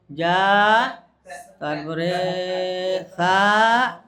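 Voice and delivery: slow, long-held syllables; dragged-out recitation. Shown as a demonstration. Teacher shows trainees how not to drill letters, dragging them out. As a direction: stretch each letter sound out sluggishly. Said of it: "এইভাবে নয়".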